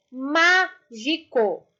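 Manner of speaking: "Mágico" very slow, in beats MÁ, GI, CO; separated syllables. Shown as a demonstration. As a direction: draw out "Mágico" syllable by syllable, starting on a strong MÁ. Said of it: "separar as sílabas".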